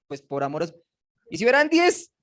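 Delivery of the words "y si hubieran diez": higher, rising tentative bargain; mock haggling. Retelling Abraham bargaining with God, lightly acted haggling. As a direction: push one last offer upward as a question.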